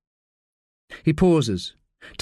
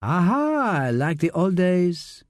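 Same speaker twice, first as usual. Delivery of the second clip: lightly voiced, French-accented woman's amusement; light French-accented tease, drawn out; a touch of laughing delight; amused Frenchwoman; 3 semitones higher, much slower than usual. A narrator's reading. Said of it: "Sabine laughs".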